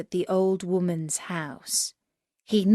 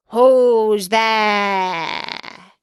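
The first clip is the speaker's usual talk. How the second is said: loud wolf voice; wolf's big voice; 6 dB louder than usual. Acted. Wolf in grandmother's bed booming at the door. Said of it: "the big voice of the wolf".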